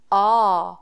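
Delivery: ordinary talk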